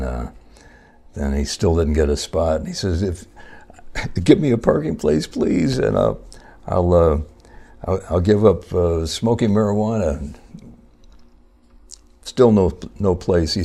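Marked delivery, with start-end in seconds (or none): 3.0-10.3 higher and pleading, with a begging "please"; hammed-up begging, more frantic than before; desperate pleading. Driver still without a spot begs God harder, mock desperation.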